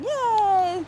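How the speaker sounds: high happy yay; excited cheer. Real, not acted. Cheering on the road.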